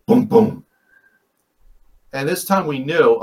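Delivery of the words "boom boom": two low, punchy booms; imitated thump. Retelling thumps on the car roof, imitated booms. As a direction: mimic two heavy thuds hitting a car. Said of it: "we heard a thump on the".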